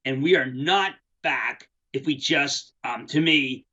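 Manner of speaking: ordinary talk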